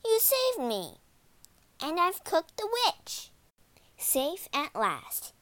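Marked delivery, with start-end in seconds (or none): 0.0-1.0 put-on high, relieved child's exclamation; boy calling out. A narrator's reading. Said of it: "called Hansel". 1.8-3.3 put-on high, gleeful child's voice; triumphant girl. A narrator's reading. Gretel announces her triumph.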